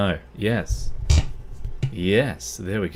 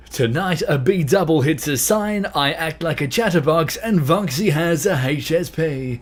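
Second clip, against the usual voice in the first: loud, raised presenter voice; Top Gear intro; 7 semitones higher, 7 dB louder than usual. Acted. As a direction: belt it out like a TV show intro. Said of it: "Top Gear intro".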